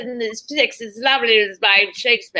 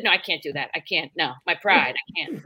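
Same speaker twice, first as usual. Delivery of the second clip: mock-dramatic refusal, much softer; quiet refusal; 8 semitones lower, 6 dB quieter than usual. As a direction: mutter it as proud stubborn denial. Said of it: "I said".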